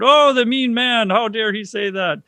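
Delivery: high, slow, hammed-up indignation; exaggerated huffy complaint about the mean man; mock outrage. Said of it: "completely horrified".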